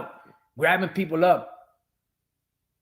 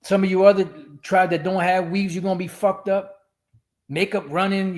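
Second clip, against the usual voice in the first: ordinary talk, nothing marked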